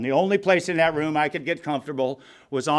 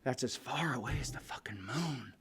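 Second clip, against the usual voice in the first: hushed whispered punchline; 5 semitones lower, 19 dB quieter than usual. Real, not acted. Sharing with the room, whispered punchline. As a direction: breathe it out in a whisper.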